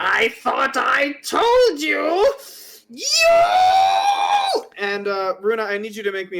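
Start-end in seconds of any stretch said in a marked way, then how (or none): 0.0-4.6 slow, rising shout into a very high scream. Acted. Carol turns on Runa, shouting accusingly. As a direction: build from high-pitched scolding to a piercing "you". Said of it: "as she's shouting at you".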